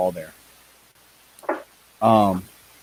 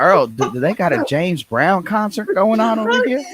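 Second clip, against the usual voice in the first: higher hammed-up hillbilly voice; 9 semitones higher than usual. Mock Southern race fan.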